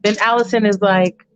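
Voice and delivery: ordinary talk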